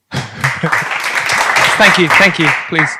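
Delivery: ordinary delivery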